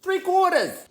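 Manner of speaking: three quarters leapt way up high, then falls; high sing-song repeat. Shown as a demonstration. Host repeating the measure for viewers, high sing-song. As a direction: sing the amount back up high, like a jingle. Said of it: "three quarters of an ounce".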